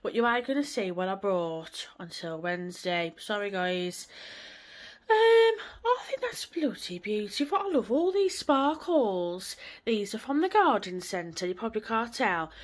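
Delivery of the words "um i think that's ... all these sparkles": high, gushing and giddy; breaking into a happy laugh; delighted. Real, not acted. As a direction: squeal over something pretty. Delighted by sparkly decorations.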